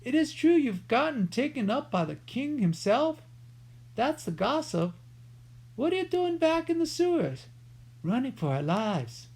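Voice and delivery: lightly voiced old man, high and chatty; a hint of a deaf old sewer man; old rat catcher. A narrator's reading. Old Tosher greeting Jemmy, lightly voiced and high.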